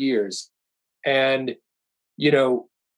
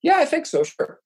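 high-pitched reply; 12 semitones higher than usual. Retold or imitated. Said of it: "they were like".

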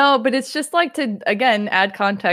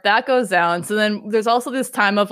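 ordinary talk

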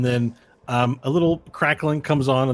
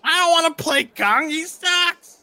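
much higher, louder whiny halfling; 8 dB louder, slower than usual. Acted. Halfling grumbling about his assigned hero, high whine. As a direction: sneer like a squeaky sore loser. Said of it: "my halfling voice".

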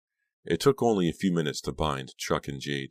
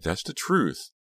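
higher, pleading insistence; crying out; 5 semitones higher, slower than usual. A narrator's reading. Dina protesting to the gunman, crying out. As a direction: plead to be believed. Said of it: "Dina cried".

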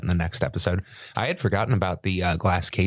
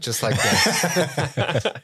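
laughing demo; 7 semitones higher, much slower than usual. Shown as a demonstration. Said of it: "Show us how that looks again".

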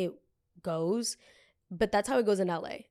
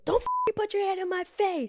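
put-on high-pitched, loud Jersey girl snap; acted-out Jersey girl yell, pitched way up; Jersey girl outburst; 13 semitones higher, 4 dB louder than usual. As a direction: play up an outraged Jersey girl. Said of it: "the Jersey girl in me just wanted to".

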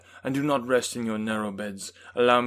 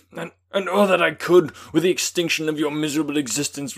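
raging, loud and higher throughout; furious detestation; 5 semitones higher, 10 dB louder than usual. A narrator's reading. Victor confronting his creature on the glacier, raging loud. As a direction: pour out hatred at the top of the voice. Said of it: "words expressive of furious detestation".

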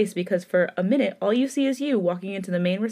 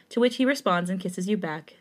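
ordinary delivery, nothing marked

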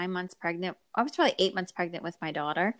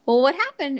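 ordinary talk

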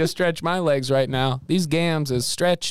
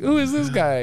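mock disbelief; 9 semitones higher than usual. Mocking disbelief at a friend.

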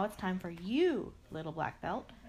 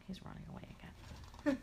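whispered aside; 4 semitones higher, 9 dB quieter, much faster than usual. Acted. Aside about bunny.

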